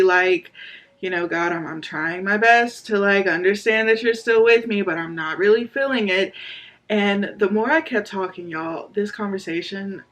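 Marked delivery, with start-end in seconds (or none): none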